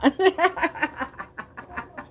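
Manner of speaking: amused trailing laugh; a giddy burst of laughter; laughing at herself; high laugh. Real, not acted. Laughs after taunt.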